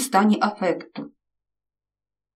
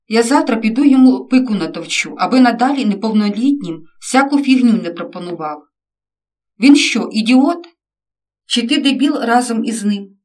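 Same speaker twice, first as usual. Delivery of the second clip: father yelling at his son, loud and furious; angry shout; 3 semitones higher, 6 dB louder than usual. A narrator's reading. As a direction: blow up and shout him down. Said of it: "зірвався на крик". (батько гнівно кричить на сина)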